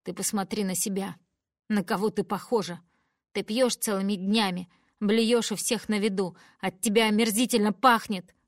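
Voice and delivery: loud, disgusted shouting at mother; angry shout. Acted. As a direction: hurl the accusations. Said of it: "кричу я". (громко и с отвращением)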